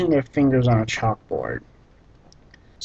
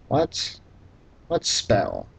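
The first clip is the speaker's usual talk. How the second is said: baffled query; 3 semitones lower, 6 dB quieter, much slower than usual. Real, not acted. Baffled by the line.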